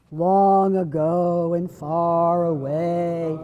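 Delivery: slow, low and drawn out. Shown as a demonstration. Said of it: "speak in a very low voice".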